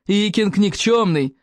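slow drawn-out taunt; gloating cry. A narrator's reading. Bully reminding everyone of the nickname, gloating. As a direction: stretch the nickname like a jeer. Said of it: "ликующе завопил Сморкало".

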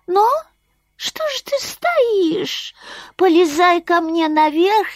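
high, slow little bat; a sleepy bat speaking sweetly; squeaky bat voice. Acted. Bat describes dreams.

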